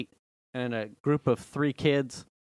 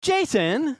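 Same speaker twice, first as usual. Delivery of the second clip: high, sharp scolding call; scolding wife; 13 semitones higher, 5 dB louder than usual. Retold or imitated. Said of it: "And she went".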